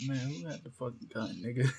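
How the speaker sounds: laughing. Real, not acted. Laughing while searching.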